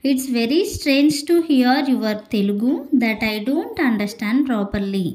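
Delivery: slow, deliberate English example; careful teacher's example; slow model sentence. Shown as a demonstration. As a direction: read it out slowly for learners. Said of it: "For example".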